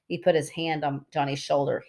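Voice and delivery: plain reading voice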